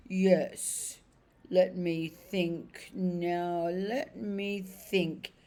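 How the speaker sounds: slow, heavy horse voice gaining force; a plodding old horse dredging up memories; remembering cab horse. Acted.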